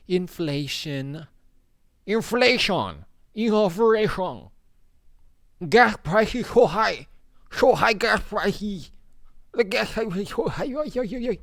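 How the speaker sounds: high, loud, slow caricature of a ranting complainer; hammed-up wail about gas prices; mock angry complainer. Mocking upset people ranting about inflation, high and loud.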